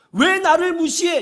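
high, slow mock outburst; acted angry indignation. Preacher quoting the phrase behind disputes, indignant outburst. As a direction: protest like someone who feels slighted. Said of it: "무시하는 것이 분노의 원인".

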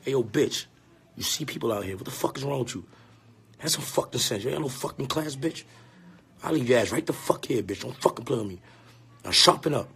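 low, soft, menacing telling-off; quiet, firm acted reprimand; hushed scolding. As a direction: scold her under your breath in public. Acts out scolding her discreetly in public.

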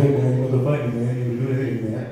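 put-on tough guy voice; mock deep voice. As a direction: play at sounding big and deep. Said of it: "a really deep voice".

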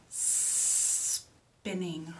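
SPINNING with a very long hissed S; long snake sound. Shown as a demonstration. Teacher has children say the word, very long S. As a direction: stretch the S at the front of spinning. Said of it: "Let's say that word".

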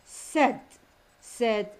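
said with a short eh; short vowel sound. Shown as a demonstration. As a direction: say said to rhyme with bed. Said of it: "here is its sound".

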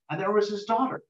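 ordinary delivery, nothing marked

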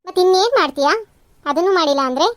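loud, challenging questions; scolding. Acted. Mother-in-law rebuking daughter-in-law over rice, loud scolding. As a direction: throw the questions at her loudly.

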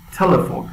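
"تلفن" with a popping, aspirated t; "تلفن" as English "TE-le-fon"; explosive T. Shown as a demonstration. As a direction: burst into the t of "تلفن", pitched high.

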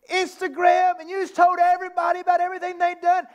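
high, incredulous scolding; frustrated disbelief. Retold or imitated. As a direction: rail at them in outraged disbelief. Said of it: "He's angry. He's upset. He's frustrated".

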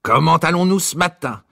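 high-pitched, bubbly greeting; exuberant tenor. A narrator's reading. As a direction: boom cheerily in a high tenor. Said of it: "de son exubérante voix de ténor".

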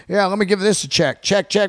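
lightly voiced sound guy; mock sound guy. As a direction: rattle it off like a lazy sound tech.

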